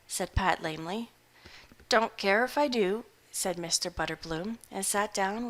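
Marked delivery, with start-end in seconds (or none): 1.9-3.1 a hungover hermit shrugging it off; gruff old farmer. Acted. Butterbloom accepts.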